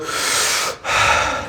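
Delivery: soft, airy sigh; demonstrated breath. Shown as a demonstration. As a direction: breathe slowly in and out audibly. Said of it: "And then you go".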